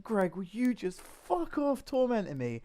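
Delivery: high, slow pleading to the fly; retold tearful plea. To the fly tormenting him, mock tearful plea. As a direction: near tears, begging. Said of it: "I was almost in tears at this point".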